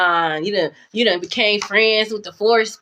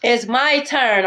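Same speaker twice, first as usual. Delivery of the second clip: slow taunt; much slower than usual. Shown as a demonstration. Repeats line, drawn out.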